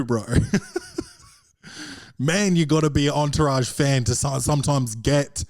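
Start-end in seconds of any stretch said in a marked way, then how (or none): 0.3-1.0 high laugh. Real, not acted. Laughing at own joke.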